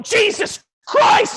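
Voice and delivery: loud, high-pitched shriek of shock; startled scream. Acted. Man startled by something strange, startled scream. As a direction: cry out as if you've just seen something terrifying.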